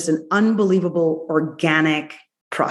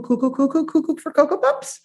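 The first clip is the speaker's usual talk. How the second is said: much higher, silly sing-song cuckoo; playful cuckoo voice; 10 semitones higher than usual. Pretend. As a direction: go goofy and high like a cereal ad. Mocking how the sweet talk sounds.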